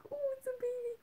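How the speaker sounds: high, soft cooing; cooing at a baby. Retold or imitated. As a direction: coo at the baby like it's the cutest thing. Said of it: "when a baby's like".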